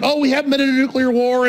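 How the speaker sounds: light mocking imitation; mimicking complacency. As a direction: parody someone blithely unconcerned. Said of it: "we have this normalcy bias".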